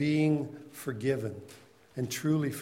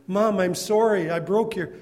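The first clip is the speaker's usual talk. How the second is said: much higher, much louder crying plea; wailing little girl; 6 semitones higher, 10 dB louder than usual. Acted. Little girl bringing the broken pieces back, wailing. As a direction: wail it like a guilty child. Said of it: "started crying again and said".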